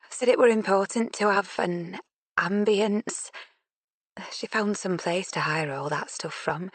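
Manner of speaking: fond Yorkshire mum remembering; soft Yorkshire accent. Acted. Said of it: "in a soft Yorkshire accent".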